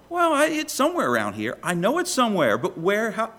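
high-pitched, hesitant acted bluster; flustered mock uncertainty. Lost young navigator answering the preacher, mock flustered. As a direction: play someone lost who won't quite admit it. Retold lost youth fumbling for directions.

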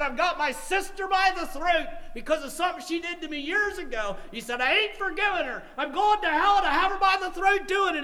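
high, loud angry rant voicing another man; imitated bitter defiance. Preacher quoting a man unforgiving of his sister, heated. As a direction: preach his grudge at full pitch. Retells man's grudge against sister.

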